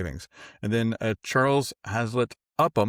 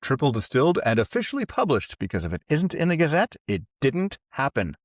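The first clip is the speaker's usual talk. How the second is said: ad read; 6 semitones higher, 4 dB louder than usual. Acted. Parody sponsor read.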